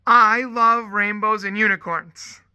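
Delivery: put-on loud, slow, proud child's announcement; imitating a small girl's big declaration; excited little girl. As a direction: blurt it out like an eager preschooler. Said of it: "this one little girl".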